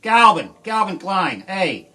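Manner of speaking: loud angry yell, slow and drawn out; yelling Calvin Klein's name out on a roadside; yelling at billboard. Retold or imitated. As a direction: bellow insults at the rich neighbour's house. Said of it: "I just stood there and went".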